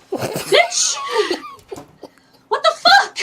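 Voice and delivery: very high, loud exasperated yell; hammed-up incredulous shout; retold outraged shriek. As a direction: screech back in mock outrage. Acts out outrage at screeching.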